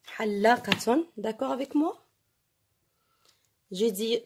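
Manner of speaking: normal talking voice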